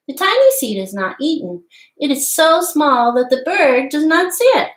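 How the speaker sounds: storybook read-aloud voice, lively and clear; reading to children. Acted. Teacher reading The Tiny Seed aloud to children, lively storybook voice. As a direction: read the tale to little listeners. Reads picture book aloud.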